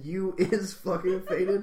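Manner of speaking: drawn-out laughing copy; mocking imitation. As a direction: repeat their phrase in disbelief, laughing. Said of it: "say it in that voice".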